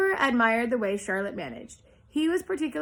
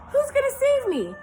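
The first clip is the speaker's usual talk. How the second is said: pleading; 5 dB louder than usual. Acted. Wilbur pleading after news.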